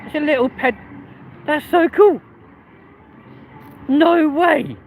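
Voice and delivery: high-pitched gushing amazement; excited delight. Real, not acted. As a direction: squeal with delight at what you see. Delighted at something seen on street.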